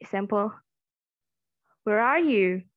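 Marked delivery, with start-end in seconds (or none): 1.9-2.6 stretched, raised are in the question; high pitch on are. Shown as a demonstration.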